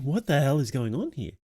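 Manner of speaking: ordinary talk, nothing marked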